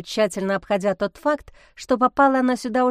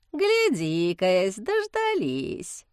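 snide drawl; much slower than usual. A narrator's reading. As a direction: drag it out with sly mockery. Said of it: "ехидно протянул Герасим". (ехидно, нараспев)

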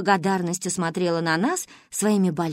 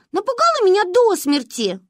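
put-on higher girl's voice, indignant; Manka complaining; 11 semitones higher, 5 dB louder than usual. A narrator's reading. Manka complains that her friend kicked her all night, a high, indignant voice.

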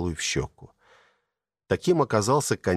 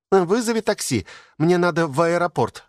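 calling out; 10 semitones higher than usual. A narrator's reading. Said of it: "донёсся из ванной голос".

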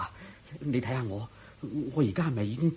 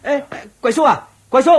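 desperate calling out; 13 semitones higher, 9 dB louder than usual. Acted. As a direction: call him back, much higher and louder. Calls after departing friend. (大聲焦急噉叫住對方)

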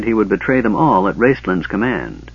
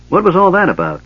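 higher, a mild gruff demand; 8 semitones higher, 4 dB louder than usual. A narrator's reading. Sturm questioning Caramon on his return, gruff. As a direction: bark it out a touch. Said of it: "Sturm asked gruffly".

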